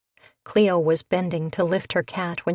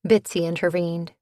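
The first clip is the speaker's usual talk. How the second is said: plain reading voice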